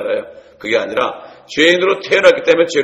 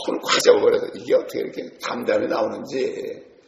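usual speaking voice

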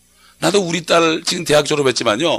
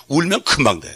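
ordinary delivery

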